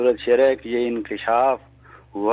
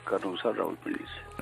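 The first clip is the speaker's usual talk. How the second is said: barely audible; 3 semitones lower, 11 dB quieter, faster than usual. Real, not acted. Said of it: "आपकी आवाज़ कम".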